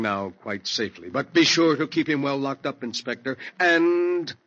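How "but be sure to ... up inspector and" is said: grim warning. Acted. Morlock's grim warning to inspector.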